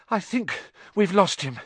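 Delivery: breathless, high-pitched report; panting. Acted. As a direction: pant it out after a long run. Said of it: "Harry panted".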